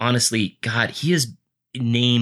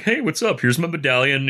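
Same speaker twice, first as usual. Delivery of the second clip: much higher, breezy hey what's up; a playful Eddie Murphy style hello; cocky greeting; 6 semitones higher than usual. Retold or imitated. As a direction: swagger through the guards like a con man. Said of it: "reminds me of, like, Eddie Murphy".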